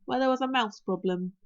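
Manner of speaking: ordinary delivery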